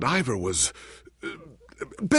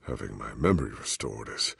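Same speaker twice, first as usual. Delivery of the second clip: normal talking voice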